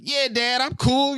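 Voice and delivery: put-on high-pitched dismissive kid; younger self. Retelling how they answered Dad as a kid, high and dismissive. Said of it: "when I was younger, I'd be like".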